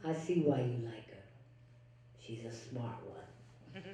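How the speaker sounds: lightly voiced boss, low and soft; a hint of the impressed older man, slow and soft; imitating the boss. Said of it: "he said".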